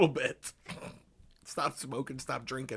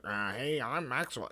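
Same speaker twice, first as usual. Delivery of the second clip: imitating Maxwell; 3 semitones lower, 5 dB quieter, slower than usual. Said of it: "He was like".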